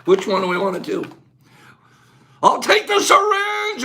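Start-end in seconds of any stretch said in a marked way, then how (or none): 2.4-3.8 excited. Retold or imitated.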